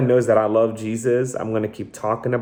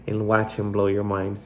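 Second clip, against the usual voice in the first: usual speaking voice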